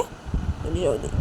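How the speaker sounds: usual speaking voice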